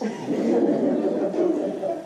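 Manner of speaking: breathy amused laugh; real laugh. Real, not acted. Laugh after teasing quote.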